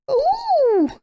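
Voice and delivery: very high ooh of wonder; little bees voice. A narrator's reading. Little bees marvelling at the new bee, high and wondering. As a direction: coo like tiny excited bees. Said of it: "said the little bees".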